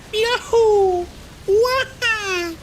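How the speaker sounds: very high, loud Mario yelp; Mario impression. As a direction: squeak it like Mario jumping. Mario sound imitation.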